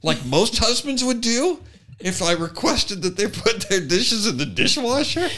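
high, fast hammed-up wife; mock shocked wife. Mocking wife's over-the-top reaction, high and fast. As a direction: overplay a stunned wife. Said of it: "She's like".

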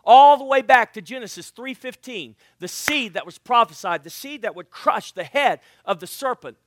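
loud, rising preacher's build; preaching at full force. Acted. Preacher booming to the church, fervent. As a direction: proclaim it to the congregation.

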